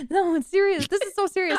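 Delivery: mock serious insistence. Real, not acted. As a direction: protest with comic gravity. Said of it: "but just the way you said it".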